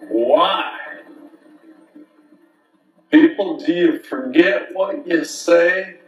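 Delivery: slow, loud, drawn-out proclamation; town crier. Acted.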